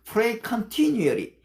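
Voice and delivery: slow, careful English scripture; slow memorized Bible English; memorized verse recital. Shown as a demonstration. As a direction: say each word deliberately, recited from memory. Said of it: "제가 이렇게 영어를 할 수 있는 거는요".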